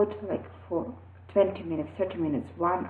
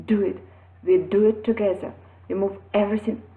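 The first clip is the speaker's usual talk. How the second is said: ordinary talk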